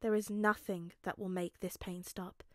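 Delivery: ordinary delivery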